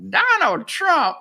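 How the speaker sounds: high, loud, slow mocking voice; mocking Hillary Clinton. Mimicking her live on air, drawn-out high mockery. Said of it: "I was mocking her".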